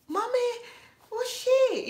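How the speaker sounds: put-on very high, wondering kid voice; imitating a small child. Midwest child asking mommy about her, high and wondering. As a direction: ask it like a curious little kid. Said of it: "kids rubbing hands on me like".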